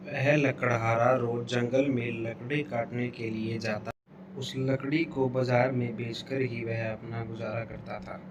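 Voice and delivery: usual speaking voice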